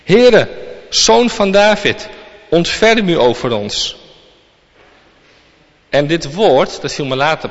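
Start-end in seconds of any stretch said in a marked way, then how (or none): 0.0-4.0 a loud, high plea of the blind men, the first word slow; crying out. A narrator's reading. Blind men calling out to Jesus, loud pleading cry. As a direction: call out to Jesus from the roadside, pleading.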